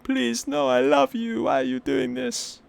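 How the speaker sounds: high whiny pleading voice, hammed up; mock pleading. Boyfriend begging his girlfriend, hammed-up high whine. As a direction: beg in a mock desperate falsetto.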